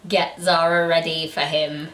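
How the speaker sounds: usual speaking voice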